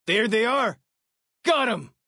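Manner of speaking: high, loud cry of spotting the enemy; excited shout. Acted. Companion alerting the player to enemies, excited shout. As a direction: call it out sharp and high.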